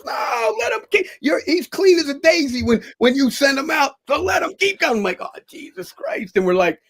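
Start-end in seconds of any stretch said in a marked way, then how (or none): 0.0-5.0 very high, hollered refusal; loud mimicked protest over the crowd; imitated shouting referee. Acts out referee refusing to stop. 5.2-6.4 high-pitched dismayed aside; reenacted alarm; retold exasperation. His exasperated reaction, retold.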